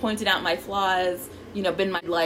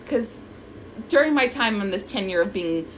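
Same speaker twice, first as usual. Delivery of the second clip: ordinary talk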